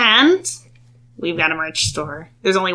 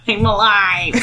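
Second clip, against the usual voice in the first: hint of a squeaky kobold; lightly voiced kobold; squeaky yappy lizard chatter; 4 semitones higher, slower than usual. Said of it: "in your best kobold voice".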